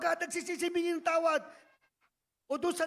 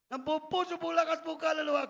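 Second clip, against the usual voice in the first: rising preacher's call, louder toward the end; preaching build; 6 dB louder than usual. Acted. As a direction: build the exhortation to a loud climax. Preacher builds to full force. (paangat na sigaw ng mangangaral)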